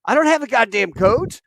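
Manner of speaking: high, loud, exasperated yell; lieutenant yelling at radio operator, furious; angry shout. Retold or imitated. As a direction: explode at the stickler on the radio. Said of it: "he goes".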